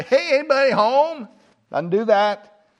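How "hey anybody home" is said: high, bright call of a drop-in guest; casual visitor calling in. Retold or imitated. Jesus poking his head in the door, calling out casually. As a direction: sing out hey like a neighbour popping in. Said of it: "pop his head through the door and say".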